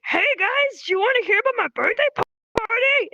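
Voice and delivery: high, hammed-up excited chatter; chirpy interrupter. Interrupter bursting into two friends' conversation, high and overeager. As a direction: butt in with a silly high-pitched voice. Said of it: "another person comes in, they're like".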